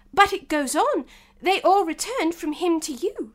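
excited. Acted. Alice objects.

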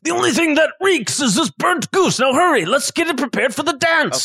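a hint of a fussy chef hurrying the cook. GM voices chef urging them.

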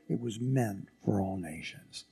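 hushed unvoiced whisper. Real, not acted. Preacher whispers the promise to the congregation. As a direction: breathe it as a secret.

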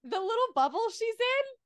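high, bright excited voice. Real, not acted. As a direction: gush about it. Excited about the scene.

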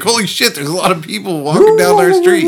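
high, loud panicked dog; excited dog voice. Pretend. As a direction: voice a freaked-out dog. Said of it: "Dog's like".

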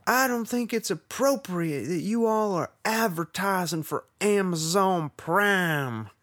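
high, loud, slowing mock Southern gentleman; Southern accent. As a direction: stretch out a fussy Southern complaint. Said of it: "I can't do it".

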